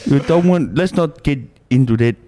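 put-on smitten Tongan-accented suitor; comic Pacific Islander accent, flirting; fob accent. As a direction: chat her up in Islander English. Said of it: "the Islander accents".

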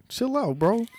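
high, loud mock scolding of a friend; excited scolding. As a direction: sharply tell a friend to cool it. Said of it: "I told Jules on the way in".

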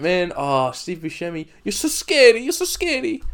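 high, gushing fan; lightly voiced starstruck fan. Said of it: "they go".

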